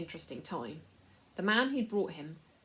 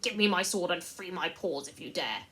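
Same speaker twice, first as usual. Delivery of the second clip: defiant mouse; 7 dB louder than usual. A narrator's reading. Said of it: "squeaked Reepicheep".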